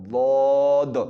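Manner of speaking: ضاد held long and loud with a d at the end; drawn-out emphatic ضاد; long daad. Shown as a demonstration. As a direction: stretch a heavy ضاد, finish on d. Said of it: "اینڈ پہ بھی ادائیگی میں دال کی بات آتی ہے".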